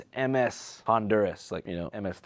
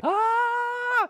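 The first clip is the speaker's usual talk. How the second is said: high loud mock wail; imitated loud wail; 4 dB louder than usual. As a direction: wail out like a sobbing kid. Crying kid's wail imitated.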